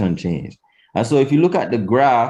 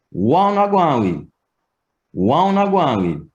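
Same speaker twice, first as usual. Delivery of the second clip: WHAT UNA GWINE WITH arched high, then falling; Patois question intonation; 5 semitones higher than usual. Shown as a demonstration. Teacher showing Patois question words, high-arched question intonation. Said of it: "Completely different intonation for English".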